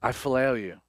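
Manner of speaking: low, soft, grieved confession. Acted. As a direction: confess it quietly, wounded and humbled. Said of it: "we're told that he's grieved".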